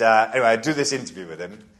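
usual speaking voice

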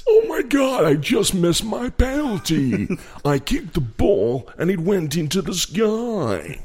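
very high, drawn-out mock presenter; hammed-up dismay in a presenter's voice; David Garrido. Presenter owning up to his missed penalty, high mock voice. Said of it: "always talks in the presenter's voice".

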